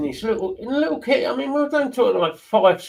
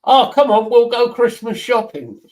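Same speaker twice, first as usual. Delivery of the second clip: imitating dad's cheery invite; 6 semitones higher, 7 dB louder than usual. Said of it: "And Dad said to me".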